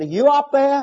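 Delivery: high-pitched call upward; mock yell. Fallen man calling up to God, mock yell. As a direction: call up to someone far above. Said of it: "but he yelled".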